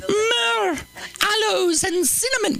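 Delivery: high, hammed-up seductress; seductive woman. Comic voicing the seductive woman from Proverbs. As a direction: coo it like a temptress.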